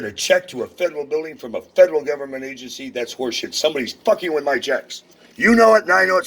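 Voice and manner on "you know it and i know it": higher, louder real yell; angry shout. Real, not acted. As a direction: hammer it home at full voice. Shouting accusation at the clerk.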